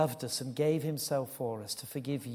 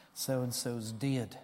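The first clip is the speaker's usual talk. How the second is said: put-on drawn-out, soft broad Batley accent; 3 semitones lower, 6 dB quieter, much slower than usual. Boy breaking news of a death to teacher, broad Batley accent. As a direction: say it slowly in broad Batley dialect. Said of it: "I'll say it how he said it".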